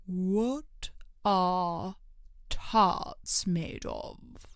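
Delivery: a king trying to sound stern; deep voice. A narrator's reading. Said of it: "he said in a deep voice".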